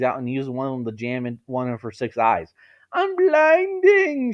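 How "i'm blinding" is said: high, slow, loud wail; near scream. Acted. Blinded spider woman wailing in pain, high and slow. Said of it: "almost screaming".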